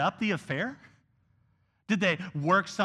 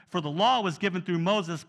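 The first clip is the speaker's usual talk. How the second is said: ordinary delivery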